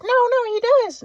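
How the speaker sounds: high-pitched caricature of a worried mother; mom impression. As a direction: squeak it out as his insistent mom. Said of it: "And my mom was like".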